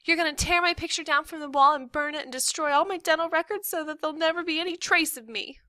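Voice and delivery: put-on high, fast kid's tirade; breakneck, high-pitched self-pity; melodramatic daughter. A narrator's reading. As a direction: rattle off the accusation like an overwrought child.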